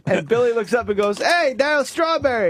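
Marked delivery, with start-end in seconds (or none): 1.2-2.5 high-pitched mock holler; imitated calling out. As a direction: call up to someone at a window. Imitates calling up to jail windows.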